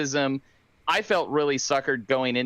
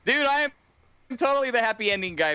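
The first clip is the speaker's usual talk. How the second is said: high, fast eager protest; excited; 8 semitones higher, faster than usual. Real, not acted. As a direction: insist eagerly, pitch up. Defending happy endings excitedly.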